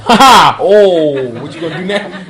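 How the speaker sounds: loud ha-ha into teasing words; playful, laughing mock reaction; laughing exclamation. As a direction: crow it through a laugh.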